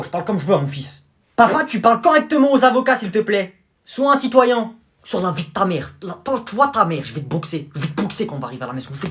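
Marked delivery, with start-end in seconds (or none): none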